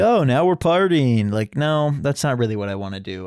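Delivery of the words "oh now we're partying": higher, louder, drawn-out party cheer; mock party whoop. Host mocking a spring-break style retirement, mock excitement. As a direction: stretch it out like a mock reveller.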